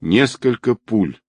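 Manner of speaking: ordinary delivery, nothing marked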